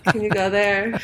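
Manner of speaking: playful cat's request through laughter; laughing. Acted. Voicing her cat, both laughing.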